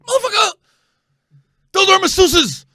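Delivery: retold yelling. Comedian shouting at his buddy over the Craigslist number, loud and high. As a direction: blast your buddy for the blunder.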